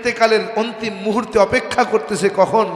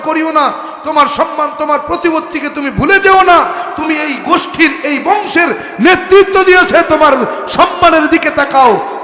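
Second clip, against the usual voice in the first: loud, raised-pitch harangue, easing at the end; angry warning; 4 semitones higher, 6 dB louder than usual. Acted. Abu Jahl warns Abu Talib against his nephew's faith, angry shouting.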